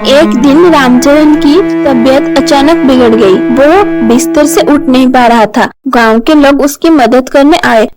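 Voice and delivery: ordinary delivery, nothing marked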